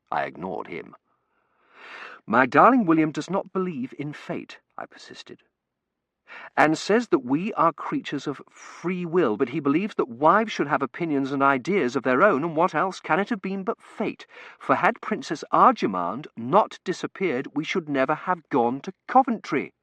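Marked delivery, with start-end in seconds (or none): 2.3-4.6 gushing bride. Acted. Reads Tossie's gushing letter. 6.6-19.7 gushing bride. Acted. Reads Tossie's gushing letter.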